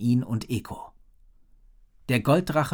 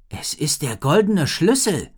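surprised; 5 semitones higher, 6 dB louder than usual. A narrator's reading. Rory recognizes the key.

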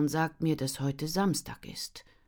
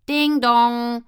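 high, loud ding-dong; doorbell chime; 9 semitones higher, 13 dB louder than usual. Acted. Doorbell rings again.

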